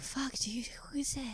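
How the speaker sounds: breathy panicked whisper. Pretend. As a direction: whisper it in alarm. Hushed panic over message.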